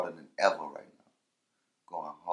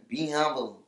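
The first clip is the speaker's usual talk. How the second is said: lightly mimicked rapper, raised pitch; 7 semitones higher, 6 dB louder, slower than usual. Rapper quoting a famous hook, light impression. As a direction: throw it out like a rap hook. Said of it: "In my [name] voice".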